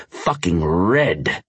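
plain reading voice

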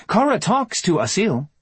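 steady, firm interruption. A narrator's reading. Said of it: "she said firmly".

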